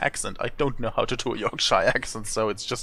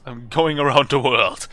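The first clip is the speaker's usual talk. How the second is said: loud, quicker boast; proud declaration; 7 dB louder than usual. Acted. Traveller boasting to the balloon seller, proud declaration. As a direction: declare it loudly.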